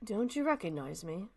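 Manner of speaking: normal talking voice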